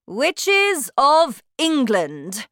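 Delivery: slow, loud commanding witch queen; shouting witch. Acted. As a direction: bellow like a terrifying witch queen. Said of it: "she shouted".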